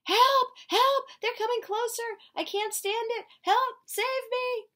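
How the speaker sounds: very high, loud, hammed-up screams; high, loud mock panic calling for help; panicked cries for help. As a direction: shriek for help like a terrified kid. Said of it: "here's Salvador".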